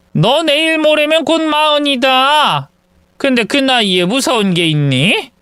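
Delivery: mild mock scolding from an old mother; scolding mother-in-law. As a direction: nag your grown son in disbelief.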